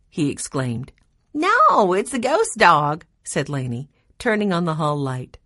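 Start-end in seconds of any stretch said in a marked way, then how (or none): 1.3-3.0 playful. A narrator's reading. Lainey teasing after the prank.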